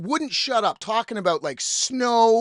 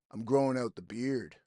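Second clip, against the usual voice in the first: low, quiet mumbly stranger; low-key voice of a guy at the bar; retold bar stranger; 8 semitones lower, 7 dB quieter than usual. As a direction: drop low and soft for the stranger. Retold bar stranger's odd opener.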